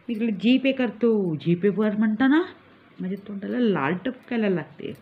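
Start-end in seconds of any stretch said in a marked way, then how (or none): none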